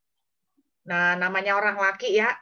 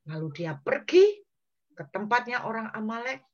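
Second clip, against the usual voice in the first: usual speaking voice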